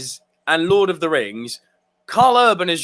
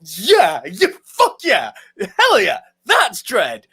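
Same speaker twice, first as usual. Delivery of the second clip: slow, punched-out triumphant cheer; hyped-up fan celebration; fist-pumping cheer; 3 dB louder, slower than usual. Acted. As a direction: cheer each yeah with relish. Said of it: "That's where you're like".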